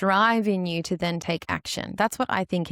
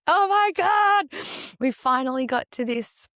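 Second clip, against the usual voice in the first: thrilled exclaim; 6 semitones higher than usual. Retold or imitated. Teachers celebrating a student's breakthrough, high and thrilled. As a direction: gush over a big win.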